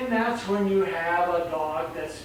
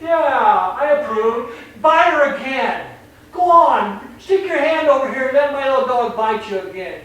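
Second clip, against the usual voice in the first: higher and louder, sarcastic encouragement; mocking indulgent owner; 5 semitones higher, 7 dB louder than usual. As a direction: egg the dog on in mock approval. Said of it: "They're going".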